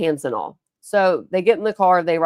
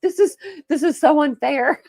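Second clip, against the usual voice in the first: high, laughing mock protest; laughing while telling her sister it's unfair; laughing complaint; 9 semitones higher, 4 dB louder than usual. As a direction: giggle through a playful whine of unfairness.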